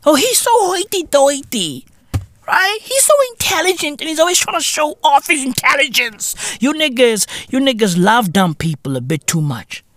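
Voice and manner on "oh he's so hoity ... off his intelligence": mock snooty critic.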